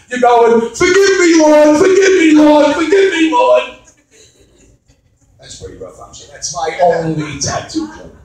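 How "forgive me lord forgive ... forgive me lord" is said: high, loud cry for forgiveness; mock frantic pleading. Comedian acting a sinner begging the Lord mid-sex, mock frantic pleading. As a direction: plead to heaven, louder and higher.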